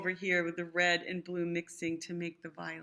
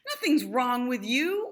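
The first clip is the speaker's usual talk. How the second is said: a storybook shout, louder; 3 semitones higher, 8 dB louder than usual. Acted. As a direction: call it out to cheer a friend up. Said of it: "Orange said with a shout".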